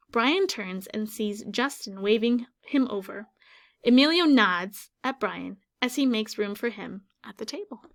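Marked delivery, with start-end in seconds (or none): none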